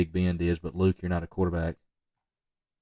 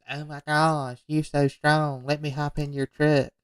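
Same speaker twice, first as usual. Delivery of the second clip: higher mock girl voice; imitated admiring girl; 7 semitones higher than usual. Girl admiring the lifter's bench, higher imitated gushing. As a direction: gush like an impressed girl, hammed-up. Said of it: "She goes".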